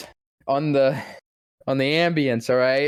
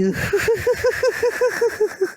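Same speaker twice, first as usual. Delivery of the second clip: very high manic cackle; shrieking villain laugh; Joker cackle. Acted. Auditioning voice actor, high manic Joker cackle. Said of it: "I just go off".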